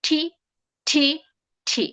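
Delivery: ঠি as a hard aspirated T; stressed true T. Shown as a demonstration. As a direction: punch each ঠি separately with a puff. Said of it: "এটা কিভাবে stress দিয়ে".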